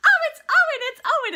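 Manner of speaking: high, very loud delighted shout; retold excited greeting. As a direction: imitate friends cheering on spotting someone. Retells guys greeting Owen excitedly.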